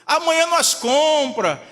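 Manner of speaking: loud mock fatherly protest; hurried father. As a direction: act out an exasperated dad refusing. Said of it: "Aí o pai fala assim".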